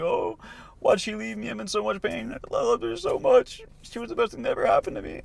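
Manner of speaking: high, wailing fake sob through the quote; mock crying. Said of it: "they'll be driving around crying".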